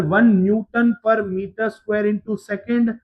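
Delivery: very slow, word by word, for students to write down; slow dictation. Shown as a demonstration. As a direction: dictate it slowly so the class can copy each word. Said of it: "एक note point के लिए". (नोट लिखवाने के लिए बहुत धीरे बोलना)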